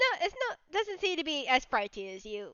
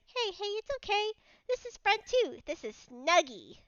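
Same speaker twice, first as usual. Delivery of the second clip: higher, gentle reassurance; reassuring the bug; 7 semitones higher than usual. Acted. Mad Lad reassuring the wary bug, higher and gentle. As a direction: coax the shy bug kindly.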